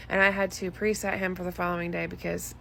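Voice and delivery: ordinary delivery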